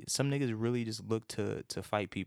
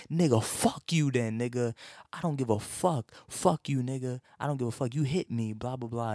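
angry cussing out; 3 semitones higher, 4 dB louder than usual. Retold or imitated. Yelling at the guy who ignored his apology, loud angry cussing. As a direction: bark it at him like you're done being nice. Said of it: "I was like".

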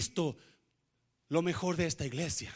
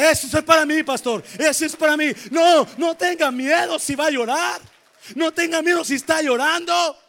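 loud, high shouted preaching; full-force preaching; 9 semitones higher, 11 dB louder than usual. Real, not acted. Pastor shouting hope to the congregation, fervent preaching. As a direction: cry it out to the church at full force.

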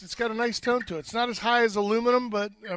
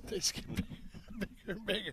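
laughing; 15 dB quieter, faster than usual. Real, not acted. Laughing through chewing joke.

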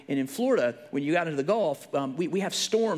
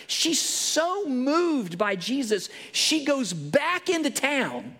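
higher, louder revival preaching; preaching at full force; 8 semitones higher, 4 dB louder than usual. Acted. Preacher retelling the woman at the well, fervent. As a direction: drive the story home to the pews.

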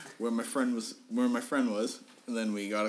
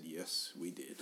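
quiet confirmation; 4 semitones lower, 10 dB quieter, slower than usual. Retold or imitated. Friend's grave morning-after confirmation.

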